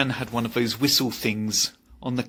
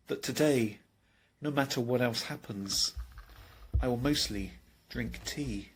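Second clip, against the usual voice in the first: soft confiding musing; 8 dB quieter than usual. Acted. Sharing the philosophy of tea with the audience, soft and intimate. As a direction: draw them in quietly.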